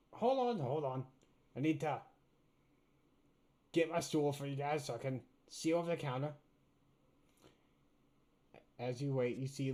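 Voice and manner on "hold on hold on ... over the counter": pixie. Acted. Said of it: "little voice be like".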